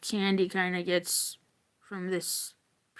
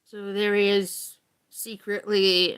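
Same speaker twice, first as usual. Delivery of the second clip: ordinary talk, nothing marked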